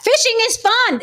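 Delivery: high, mock-chirpy fishing is fun; mock chat voice. Mocking the chat viewers who defend fishing, high and chirpy. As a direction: parrot the chat in a silly high voice. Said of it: "People are like, in the chat".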